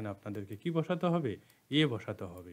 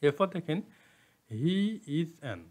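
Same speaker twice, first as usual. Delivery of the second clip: ordinary talk, nothing marked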